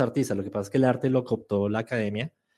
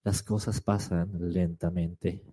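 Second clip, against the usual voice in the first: low, very slow and softer narrator voice; voice-over narration; 7 semitones lower, 5 dB quieter, slower than usual. Shown as a demonstration. Teacher demonstrating a video voice-over about time, slow narration. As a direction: stretch it out like a languid voice-over. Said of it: "la voz de alguien diciendo".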